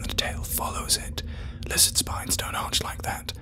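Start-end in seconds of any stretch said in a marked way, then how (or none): none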